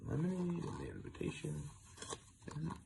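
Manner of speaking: fading into a whisper; soft aside. Real, not acted. Reader naming things in the picture, trailing off softly. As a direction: murmur the picture details.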